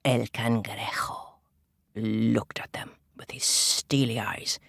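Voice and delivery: low slow whisper; ominous hush. A narrator's reading. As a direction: build menace slowly. Ominous narration.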